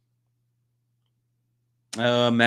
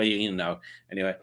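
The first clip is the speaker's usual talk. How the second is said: ordinary talk, nothing marked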